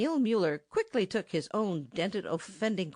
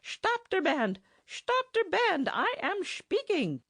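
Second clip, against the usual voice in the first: shouting over the band; slower than usual. Acted. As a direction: yell to be heard above the noise. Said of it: "vigorous interruption".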